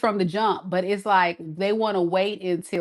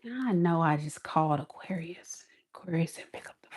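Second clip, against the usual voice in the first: hushed, secretive whisper; 3 semitones lower, 10 dB quieter, faster than usual. Pretend. The ex after Aquarius ignores the call, whispering. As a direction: whisper it like nobody should hear.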